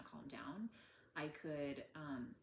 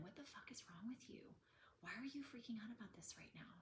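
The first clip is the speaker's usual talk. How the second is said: higher, softer whisper-hiss of self-scolding; harsh inner critic; 6 semitones higher, 7 dB quieter than usual. Shown as a demonstration. Harsh inner voice berating herself, whispered. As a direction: scold yourself in a tense whisper. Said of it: "rather than".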